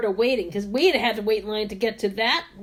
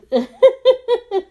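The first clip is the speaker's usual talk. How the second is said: high squealing laugh; 10 semitones higher, 8 dB louder than usual. Real, not acted. Teller bursts out laughing.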